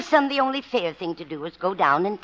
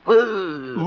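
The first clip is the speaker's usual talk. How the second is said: mock bear growl; 2 semitones higher than usual. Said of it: "The bear growled at him".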